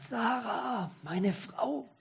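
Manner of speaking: a lightly voiced dying father calling for his children, slow and strained; weak old man straining to find his family. A narrator's reading. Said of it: "mit leiser und verzogener Stimme spricht er".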